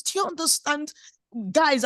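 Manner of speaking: ordinary delivery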